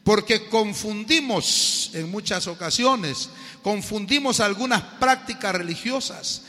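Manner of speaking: loud, slow, emphatic preaching; preaching at full force. Acted. Preacher driving home the lesson to the congregation, loud and slow. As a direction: hammer the point home to the congregation.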